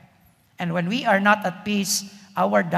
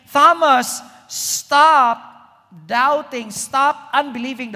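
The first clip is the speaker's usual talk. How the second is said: louder, higher, calling Thomas to stop; commanding call; 4 semitones higher, 6 dB louder, slower than usual. Acted. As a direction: call him out firmly by name. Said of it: "It's like Jesus saying".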